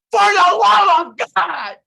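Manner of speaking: high, loud, drawn-out wail; hammed-up despairing shout; anguished mock cry. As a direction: overact a gambler cursing their luck. Acting out a losing card player.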